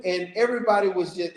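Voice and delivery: usual speaking voice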